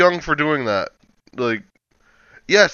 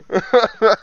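short laugh; 8 semitones higher than usual. Real, not acted. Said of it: "Stop making me laugh".

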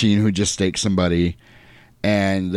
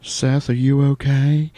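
slow, higher mock girl's voice; Kate; 4 semitones higher, slower than usual. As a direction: draw out the question in a put-on voice. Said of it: "and she's like".

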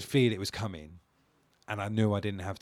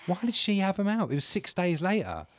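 mock indignant; 10 semitones higher, 4 dB louder than usual. Mock blaming the girlfriend.

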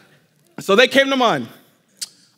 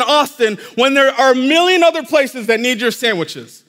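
higher, louder, building hammed-up indignation; mock outrage; 5 semitones higher, 4 dB louder than usual. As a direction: pile up the places in pretend exasperation. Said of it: "how ridiculous would it be".

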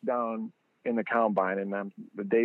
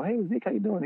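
much higher excited hello; eager greeting; 11 semitones higher than usual. Retold or imitated. Fan greeting a young star, high and excited.

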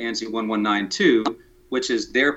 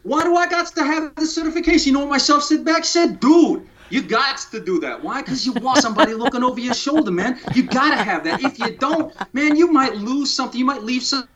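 high, hammed-up New York accent; exaggerated New York accent pitched way up; 11 semitones higher than usual. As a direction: play a comic New York street guy in a New York accent. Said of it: "I'm gonna put it in a New York accent".

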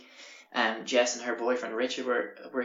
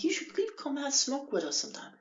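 high falsetto invitation, hammed up; exaggerated high-pitched neighbour; inviting neighbours; 13 semitones higher than usual. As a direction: coax sweetly in a squeaky put-on pitch. Voices the neighbours inviting him.

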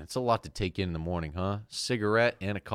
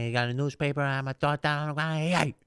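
Joe Pesci impression; 6 semitones higher than usual. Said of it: "it sounds like Joe Pesci".

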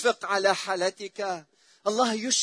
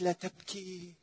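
soft tender voice; 7 dB quieter than usual. Retold or imitated. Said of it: "ولما سمعت".

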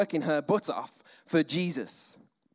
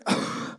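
Martha's sigh. Retold or imitated.

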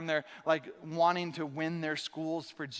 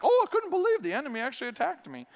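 mock surprise; 6 semitones higher, 3 dB louder, faster than usual. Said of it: "we act surprised".

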